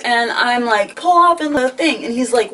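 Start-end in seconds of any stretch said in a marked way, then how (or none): 1.0-2.0 pitched-up freaked-out passenger; panicked yelling. Retold or imitated. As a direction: yell at him to stop the car. Yelling at driver.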